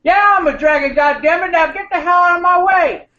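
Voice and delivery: high, loud angry dragon voice; Samuel L. Jackson dragon. Retold or imitated. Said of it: "Samuel L. Jackson as a dragon".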